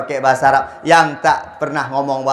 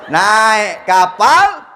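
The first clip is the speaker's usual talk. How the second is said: drawn-out laughing; 8 semitones higher, 5 dB louder, much slower than usual. Real, not acted.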